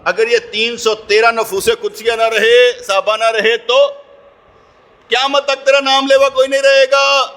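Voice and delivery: loud, high, drawn-out cry to God; tearful plea retold. Preacher voices the Prophet's plea at Badr, impassioned loud imploring. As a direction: plead at full voice, stretching the words slowly. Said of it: "رو رو کر عرض کریں".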